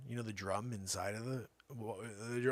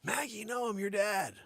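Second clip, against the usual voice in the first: much higher, pleading cry; mock panic; 11 semitones higher, 4 dB louder than usual. Owner begging the dog not to swallow him, panicked. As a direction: beg the dog not to eat you.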